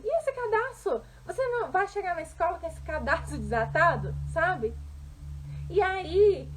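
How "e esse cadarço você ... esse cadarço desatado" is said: high-pitched nagging question, then scolding; critical mother. Acted. Mother inspecting her daughter before school, critical and higher-pitched. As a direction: pick at her like a fault-finding mother. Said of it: "olhava pra ela e falava assim".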